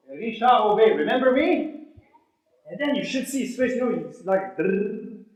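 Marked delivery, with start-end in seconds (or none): none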